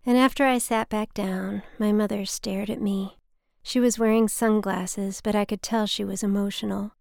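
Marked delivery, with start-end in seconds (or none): none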